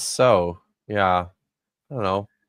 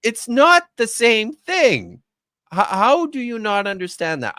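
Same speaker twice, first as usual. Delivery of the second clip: exasperated insistence; 10 semitones higher, 3 dB louder than usual. Acted. Critic voicing everyone's reply to the detective, exasperated. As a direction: plead the obvious in mounting exasperation.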